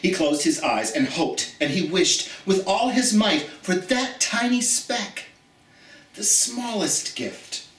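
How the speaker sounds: put-on gentle read-aloud storytelling for children; story reading. Teacher reading a picture book aloud to her students, storytelling.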